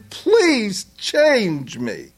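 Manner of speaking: high, pleading voice of a desperate believer; a struggler crying out to God in a high plea; pleading sinner. Retold or imitated. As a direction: beg God for change, voice climbing. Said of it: "and they're saying".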